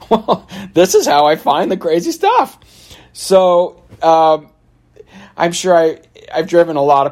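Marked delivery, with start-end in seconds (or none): 0.1-2.6 laughing. Retold or imitated. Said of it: "And I'm".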